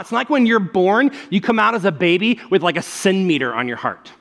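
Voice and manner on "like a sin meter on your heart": ordinary talk, nothing marked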